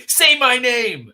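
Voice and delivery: slow, high, loud mock menace; dramatic demand. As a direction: ham it up like a movie villain. Jokes about his own name.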